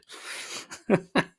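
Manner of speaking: breathy, voiceless chuckle; short chuckle. Real, not acted. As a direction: chuckle at your own joke. Laughs at own flogging joke.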